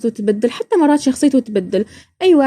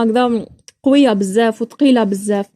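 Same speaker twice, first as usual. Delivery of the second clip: usual speaking voice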